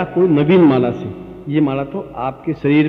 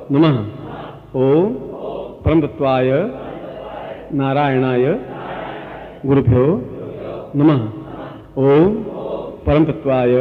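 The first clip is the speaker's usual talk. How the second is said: guru mantra recitation. Shown as a demonstration. Guru giving the guru mantra for disciples to repeat. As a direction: intone each word and wait. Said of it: "मैं गुरु मंत्र आपको दे देता हूं".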